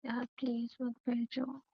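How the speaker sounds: very soft, reluctant plea; hushed pleading. Acted. Woman begs him not to send her in, hushed pleading. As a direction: beg under your breath, uneasy.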